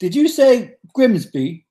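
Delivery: put-on high, slow Grimsby accent; Ant. Impersonating a man from Grimsby, high slow accent. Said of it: "he's from Grimsby".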